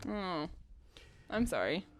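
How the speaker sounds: usual speaking voice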